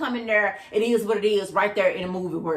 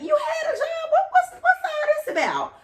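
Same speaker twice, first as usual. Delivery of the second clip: very high, squealed indignation; exasperated disbelief. Retold or imitated. Woman questioning her employed date's cheapness, squealing and exasperated. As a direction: shoot way up in pitch, demanding answers.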